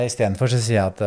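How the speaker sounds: usual speaking voice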